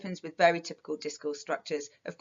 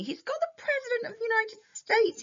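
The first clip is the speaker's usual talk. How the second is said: excited. Acted. Excited point about Leno's big guest.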